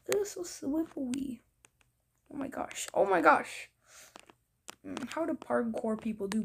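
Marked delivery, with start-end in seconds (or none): 2.3-2.9 breathy whispered oh my gosh; whispered gasp. Real, not acted. Reacting to game scene. 2.9-3.7 higher, louder oh my gosh; surprised. Real, not acted. Reacting to game scene.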